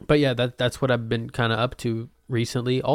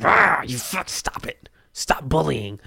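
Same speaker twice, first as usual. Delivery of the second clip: strained, hushed play-rage at a pet; mock angry scolding; 4 semitones higher, slower than usual. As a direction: growl and scold under your breath, hammed-up. Imagined scolding of bully fish.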